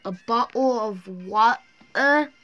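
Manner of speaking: drawn-out mock British "A bottle of water?"; a slowed, hammed-up British "water"; slow British accent. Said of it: "British people be like".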